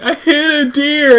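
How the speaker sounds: high, drawn-out tearful wail; mock crying. Imitating a crying friend confessing by phone, mock wail. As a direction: blubber it slowly, hammed-up. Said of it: "you're just crying".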